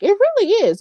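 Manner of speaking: sudden high emphatic lift on really; excited agreement. Real, not acted. Agreeing with a viewer that Carlito is back, excited.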